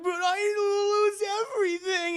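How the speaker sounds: high, drawn-out loud whine; mock wail. Fired photographer pleads with Peter, high mock wail. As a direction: wail it out, hammed-up. Retold Eddie wails after firing.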